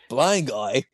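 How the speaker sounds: hammed-up attack cry, rising to DIE; mock attacker taunts. Mock thug punching the blind guy, hammed-up high taunt. Acting out attackers hitting Matt.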